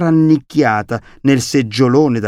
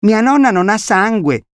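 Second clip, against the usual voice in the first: put-on high, eager child's voice; hasty little boy; 10 semitones higher, 4 dB louder than usual. A narrator's reading. Boy adding facts about grandmother for visitor, high childish eagerness. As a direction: blurt it out as a small boy. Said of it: "aggiunse in fretta Camillo".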